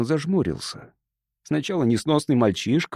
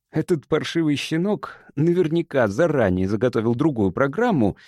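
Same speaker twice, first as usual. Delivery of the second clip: ordinary delivery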